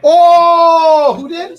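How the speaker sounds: loud, high surprised "oh". Real, not acted. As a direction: blurt it out in surprise. Surprise at new bid.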